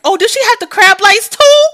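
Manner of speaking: very loud, high wail of disbelief; high-pitched, wailing outburst; shocked wail. Real, not acted. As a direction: cry out in over-the-top horror. Shocked at pregnancy and crabs news.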